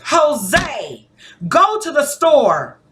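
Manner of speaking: high, loud, slow insistent demand; loud urgent command. Shown as a demonstration. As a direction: order it as if adding NOW. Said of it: "my volume even went up".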